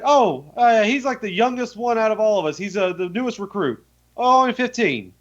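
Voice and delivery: earnest bandit sharing news. Retold or imitated. Bandit explaining Benny's youth.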